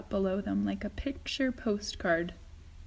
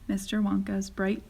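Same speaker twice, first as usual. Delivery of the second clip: usual speaking voice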